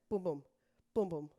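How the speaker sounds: rhythmic dance count, punching each boom; calling counts. Shown as a demonstration. Instructor counting the class through each accent. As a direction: call the beats for the class.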